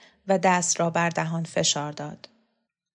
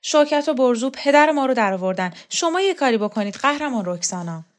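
loud, higher outburst of complaint; abruptly raised voice; 5 semitones higher, 5 dB louder than usual. A narrator's reading. Pari appeals to Roxana against Shokat and Borzu, voice suddenly raised. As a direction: raise the voice suddenly, then ease slightly into an appeal. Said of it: "صدا را بی مقدمه بلند کرد".

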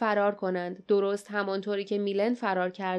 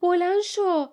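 high, loud urgent shout; 9 semitones higher, 5 dB louder than usual. Acted. Jasmine shouts at the fallen Milen to rise. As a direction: yell at him to get up now. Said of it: "فریاد زد".

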